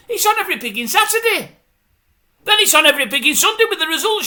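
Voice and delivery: high, loud tirade building to a shout; exasperated rant. Real, not acted. Viewer ranting about endless scams and dance items, outraged. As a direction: work yourself up until you are yelling.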